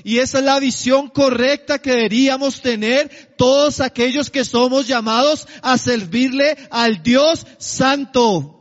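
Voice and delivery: usual speaking voice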